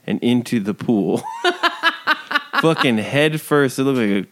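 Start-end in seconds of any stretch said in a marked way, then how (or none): 1.3-2.6 a high laugh at the joke; high burst of laughter. Real, not acted. Laughing at own rant.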